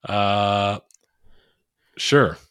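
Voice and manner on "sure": normal talking voice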